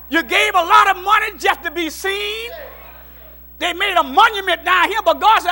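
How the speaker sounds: loud rising preacher's cry; fiery preaching. Acted. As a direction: preach it at full force. Preacher builds to full force.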